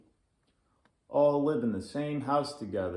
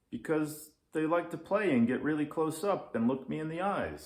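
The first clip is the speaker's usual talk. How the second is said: little Leo; 8 semitones higher, 4 dB louder than usual. Acted. Little Leo answers daddy.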